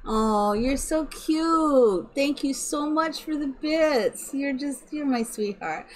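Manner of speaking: sweetly overjoyed, stretching the words; gushing thanks. Real, not acted. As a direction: coo a big, slow thank-you.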